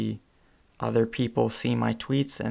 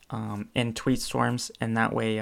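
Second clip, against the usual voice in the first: ordinary talk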